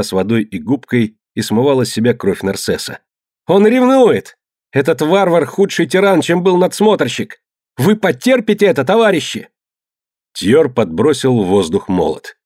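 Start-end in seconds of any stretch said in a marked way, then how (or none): 3.5-9.5 frantic cried-out accusation; desperate accusation. A narrator's reading. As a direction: stir up the crew with a raised, high voice. Accused man rouses crew against accuser.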